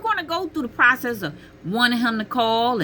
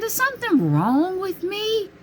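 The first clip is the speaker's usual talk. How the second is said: high bewildered question; 13 semitones higher than usual. Retold or imitated. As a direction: ask it up high, confused. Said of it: "I, I said".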